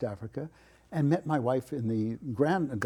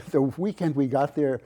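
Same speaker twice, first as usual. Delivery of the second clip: normal talking voice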